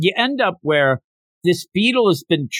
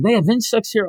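high-pitched mock alarm; half-voiced crowd outcry; mock yelling; 7 semitones higher than usual. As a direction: play a panicked diner, without real volume. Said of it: "Everybody starts yelling".

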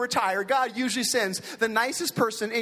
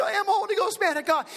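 crying; 7 semitones higher than usual. Retold or imitated. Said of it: "I started crying".

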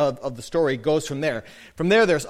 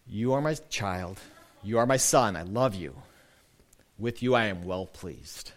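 tender blessing; 7 semitones lower, 6 dB quieter than usual. Acted. Voicing God's blessing, tenderly.

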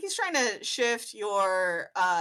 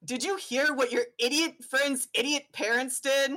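high-pitched, gossipy outraged father; indignant dad; 5 semitones higher than usual. Acted. Dad ranting to his son about the barbecue invite, outraged. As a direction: huff about the neighbours' betrayal.

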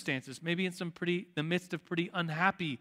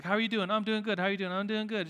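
higher, rattled-off empty pleasantries; mock small talk; 4 semitones higher, faster than usual. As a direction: trade rote greetings back and forth. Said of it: "wasting three minutes of small talk".

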